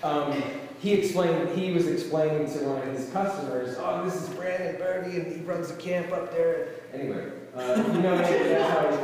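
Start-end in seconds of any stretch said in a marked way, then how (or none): 3.8-6.7 higher-pitched mock owner's voice; a raised, lightly acted impression of an acquaintance; imitating a restaurant owner. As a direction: lightly put on the owner's chatty introduction. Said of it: "that's how he talks".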